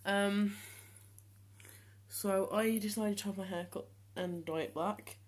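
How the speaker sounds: usual speaking voice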